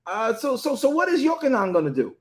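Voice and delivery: change the subject, cheeky; nosy deflection. Retold or imitated. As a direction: Peter deflecting to ask about Yochanan, sassy. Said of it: "Yeshua had to check him".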